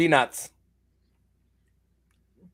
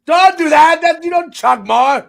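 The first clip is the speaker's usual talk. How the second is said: high, loud alarm; loud urgent warning; 9 semitones higher, 8 dB louder than usual. Real, not acted. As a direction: stop him, loudly and urgently. Warning the gagging friend.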